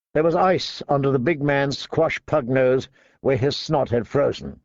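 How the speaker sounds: ordinary delivery, nothing marked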